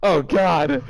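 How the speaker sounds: ordinary talk, nothing marked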